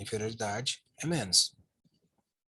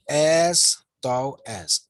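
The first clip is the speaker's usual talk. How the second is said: very slow and louder, each word held; slow emphasis; 6 dB louder, much slower than usual. Shown as a demonstration. Teacher showing the class the blue phrase, very slow and louder. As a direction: stretch every word to highlight it.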